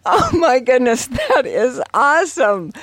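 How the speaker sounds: high, delighted exclamation; real delight. Real, not acted. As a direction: exclaim it high, reacting to a great joke. Co-host delighted by the joke.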